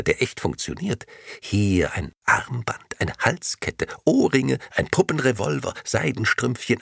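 building excitement. Acted. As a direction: pile on the gifts eagerly. Listing gifts, building.

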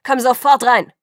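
loud shout from far off; roaring. A narrator's reading. Father calling Bella from the house, yelling. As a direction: bellow her name across the yard. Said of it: "brüllte Charlie".

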